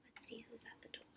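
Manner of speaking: quick hushed whisper; suspense whisper. Shown as a demonstration. Reader whispering to children before the reveal. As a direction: whisper it like a secret. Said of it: "aside to listeners, building suspense".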